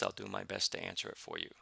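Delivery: ordinary delivery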